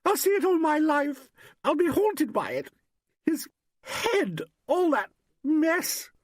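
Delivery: very high, distraught outburst; high-pitched hysterical wailing; rising hysteria. A narrator's reading. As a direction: let the horror push your voice right up. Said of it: "voice rose hysterically".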